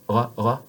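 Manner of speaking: "ra" with a throaty French "r", mouth open. Shown as a demonstration. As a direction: uvular "ra", tongue tip hidden. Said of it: "You don't see my tongue".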